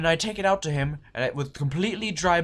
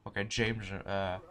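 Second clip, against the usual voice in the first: normal talking voice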